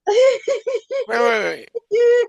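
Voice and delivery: high, loud, cracking with laughter; can't believe what you're hearing; squealing wait wait wait; shocked laughing wait. Real, not acted. Disbelief at gold-teeth cowboy.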